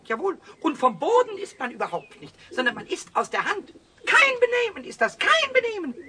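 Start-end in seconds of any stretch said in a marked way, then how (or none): 4.1-5.9 laut und hoch, empört tadelnd; empörtes Schimpfen. Acted. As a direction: die Tauben entrüstet zurechtweisen. Pumuckl schimpft mit den Tauben. (laut empörtes Schimpfen)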